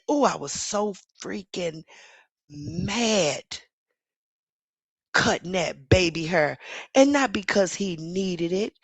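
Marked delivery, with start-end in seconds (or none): none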